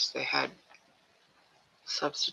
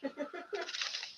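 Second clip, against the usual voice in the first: high laugh; 14 dB quieter than usual. Real, not acted. Laugh between readings.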